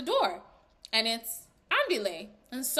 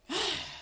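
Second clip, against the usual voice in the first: audible sigh on finishing; relieved sigh; 6 semitones higher, 6 dB quieter than usual. Real, not acted. Reviewer finally through the review, sighing. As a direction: let out a breath at the end.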